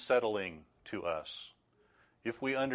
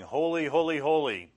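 raised, louder chant of praise; seraphim calling out; 7 semitones higher, 7 dB louder than usual. Acted. Seraphim crying out to each other around God's throne, ringing call. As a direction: call it out high and loud, like seraphim. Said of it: "The seraphim flying around saying".

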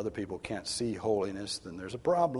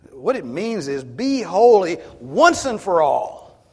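slow, loud, driving emphasis on once; preaching at full force; 8 semitones higher, 14 dB louder, slower than usual. Acted. Preacher urging the church, loud and slow. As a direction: hammer it home to the congregation.

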